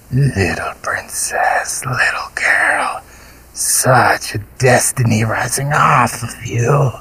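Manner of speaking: slow, hungry creature whisper; monster whisper. A narrator's reading. Golgoroth crooning to the princess in a slow creature whisper. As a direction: whisper like a beast savoring prey.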